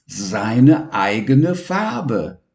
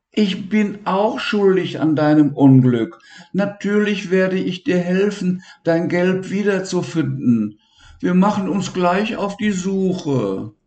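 higher, comforting friend; higher, consoling boy's voice; Levi reassuring; 9 semitones higher than usual. Acted. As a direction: promise help kindly.

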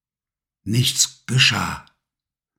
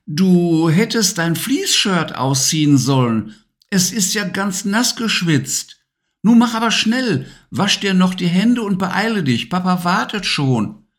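higher mum's voice hurrying him along; urging mother; 8 semitones higher than usual. Acted. Mama urging Levi to wash hands, higher hurrying mum voice. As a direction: chivvy him to dinner like a busy mum. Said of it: "forderte Mama ihn noch einmal auf".

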